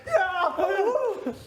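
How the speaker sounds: high laughing "yeah" from the crowd; laughing cheer. Retold or imitated.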